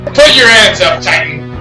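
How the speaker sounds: forceful shouted command; police command. Acted. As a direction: bark it like an arresting officer. Swat leader confronts killer.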